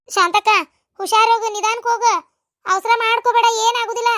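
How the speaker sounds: loud, high parting call; calling after someone. Acted. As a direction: call out a caring warning as she leaves. Calling after the departing woman.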